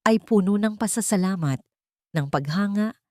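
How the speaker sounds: ordinary delivery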